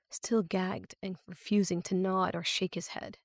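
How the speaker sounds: usual speaking voice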